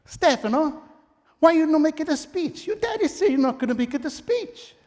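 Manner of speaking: high Italian mother coaxing; Italian mama accent. Retold or imitated. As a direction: coax him like a loving Italian mama. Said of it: "She says".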